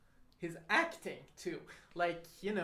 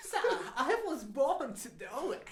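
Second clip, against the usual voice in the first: higher, slow, hammed-up impression; Christopher Walken; 8 semitones higher, 4 dB louder than usual. Shown as a demonstration. Co-host echoing the botched impression, high and slow. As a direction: draw it out high with a laugh. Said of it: "oh, that was Christopher Walken".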